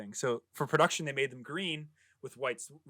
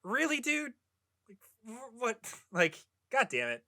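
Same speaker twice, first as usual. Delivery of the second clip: exasperated; 9 semitones higher than usual. Retold or imitated. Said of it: "and I was like".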